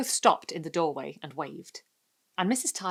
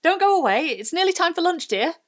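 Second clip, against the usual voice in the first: a mother's carrying call across the yard; 12 semitones higher, 11 dB louder than usual. A narrator's reading. Mother calling Carol in for lunch, loud and high. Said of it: "she called emphatically".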